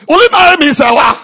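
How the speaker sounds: shouted proposal. Shown as a demonstration. Preacher mocking a suitor yelling his proposal at Sarah. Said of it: "Love is expressed in a small voice".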